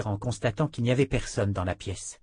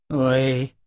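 growled; 2 semitones higher, 5 dB louder than usual. A narrator's reading. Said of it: "grogna Kara".

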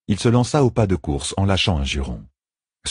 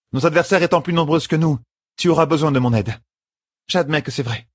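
higher-pitched, reasoned insistence; determined woman; 10 semitones higher than usual. A narrator's reading. Sister offering help to her leader, determined. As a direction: make the case as a resolute ally. Voices sister conceding need.

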